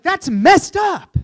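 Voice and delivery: loud, high, slow outraged yell. Retold or imitated. Guest yelling at Quincy mid-story, outraged. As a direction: blurt it out, shocked by the story. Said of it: "very surprised why I would yell".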